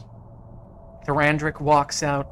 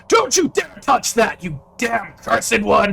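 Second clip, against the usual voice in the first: much higher, loud angry yell; furious shout; 8 semitones higher, 9 dB louder than usual. Acted. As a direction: bark the threat at the intruder. Guard shouts at hero.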